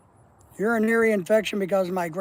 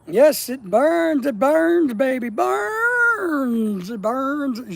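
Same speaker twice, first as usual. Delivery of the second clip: mock pained wail; 5 semitones higher, 5 dB louder, much slower than usual. Mock pained reading of chat.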